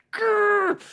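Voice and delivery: high loud effort grunt; straining growl. Retold or imitated. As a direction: strain with everything you've got. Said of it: "every ounce of my being".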